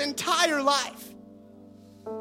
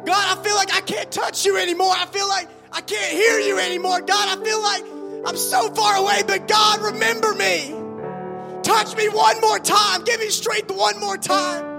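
crying out to God; 4 semitones higher than usual. Acted. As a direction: beg God at full voice. Preacher voices a desperate prayer.

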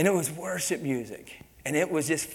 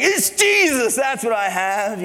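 much higher, louder burst on "Jesus", then slow; proud declaration; 9 semitones higher, 10 dB louder, slower than usual. Retold or imitated. Preacher answering the watching workers, proud loud declaration. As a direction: announce it proudly. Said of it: "I wanna be like".

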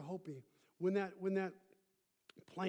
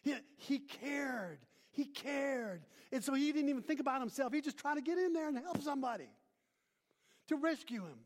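fast preaching; 7 semitones higher, 3 dB louder than usual. Acted. Preaching at full force.

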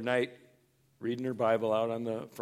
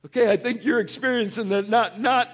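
higher, louder, patient prodding; gentle correction; 9 semitones higher, 10 dB louder than usual. Retold or imitated. Pastor voicing God, a higher, louder patient nudge. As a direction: nudge him back on track.

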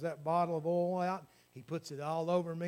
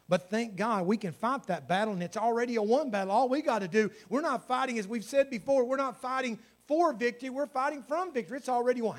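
higher, driving pulpit delivery; fervent preaching; 6 semitones higher, 7 dB louder than usual. Real, not acted. Preacher telling the church the battle is won, fervently. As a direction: preach it with mounting conviction. Preacher rises to full force.

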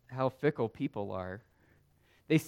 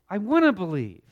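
desperate father; 5 semitones higher, 8 dB louder than usual. Acted. Preacher voicing the desperate father.

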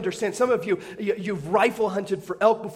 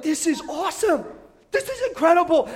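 thrilled inner voice; 8 semitones higher than usual. Retold or imitated. Hunter thrilled by the elk.

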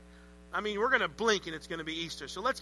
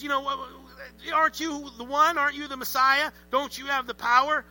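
higher, louder mocking taunt; arrogant taunt; 6 semitones higher, 4 dB louder than usual. Criminal sneering at Jesus, higher arrogant taunt. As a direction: taunt him with smug arrogance. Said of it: "he's arrogant".

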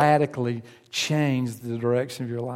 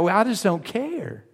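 high, dismissive shrug of a voice; playacted indifference; mock apathy; 7 semitones higher than usual. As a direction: wave it away like it doesn't matter. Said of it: "we're so apathetic about it".

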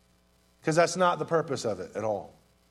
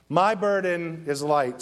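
normal talking voice

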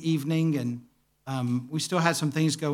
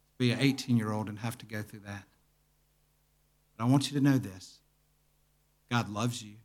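gentle comfort; 4 semitones lower, 4 dB quieter than usual. Retold or imitated. Comforting a grieving man.

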